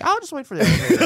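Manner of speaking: fast, high, laughing; laughing quote. Retold or imitated. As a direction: squeeze it out laughing. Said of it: "I'm like".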